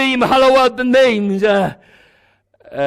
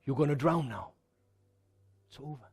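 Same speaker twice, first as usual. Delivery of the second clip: the devil's taunt; 9 semitones lower, 15 dB quieter, faster than usual. Acted. Pastor voices the devil taunting the drowning believer, low and soft. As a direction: lower and much softer, a menacing murmur. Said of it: "you can hear him say".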